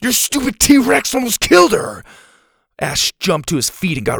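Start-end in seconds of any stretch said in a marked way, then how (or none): 0.0-2.0 high, loud accusation; distraught kid lashing out; tearful yell. A narrator's reading. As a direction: yell blame, close to tears. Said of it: "Ash yelled, practically in tears".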